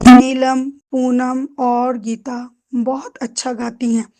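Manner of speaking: "Neelam" called out loud, then slow with a break after each name; pauses between names. Shown as a demonstration. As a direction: list "Neelam", "Poonam" and "Gita" slowly with small stops. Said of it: "जैसे".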